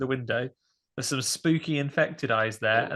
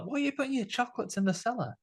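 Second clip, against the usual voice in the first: put-on puzzled old lady; 10 semitones higher, 5 dB quieter than usual.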